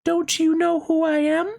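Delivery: very high, gentle fairy voice. Acted. Fairy greeting the little rabbit, high and gentle. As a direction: sing it out light and high like a fairy. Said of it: "she said".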